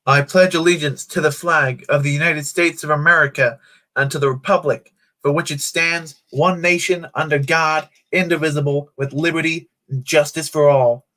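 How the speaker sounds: put-on recited pledge morphing into an American accent; putting on an American accent. Streamer reciting the pledge for viewers, hammed-up American accent. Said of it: "tried to morph it into an American accent".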